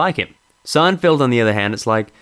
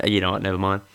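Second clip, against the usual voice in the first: usual speaking voice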